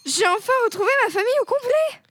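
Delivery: loud, high cry of joy; hammed-up elated outburst; overjoyed. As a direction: exclaim with theatrical relief at a reunion. Acted joy at family reunion.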